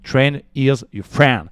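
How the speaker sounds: careful French-accented English delivery. Shown as a demonstration. English catchphrase, French speaker, showing accent.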